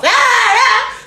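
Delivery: high loud shouted ah ah; mock shout. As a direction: shout like an excited fan. Imitating fans shouting.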